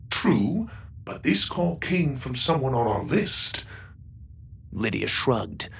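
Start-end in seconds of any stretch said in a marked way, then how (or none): 0.1-3.6 higher, even robot voice. Acted. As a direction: speak like a polite ship robot. Goro robot talks.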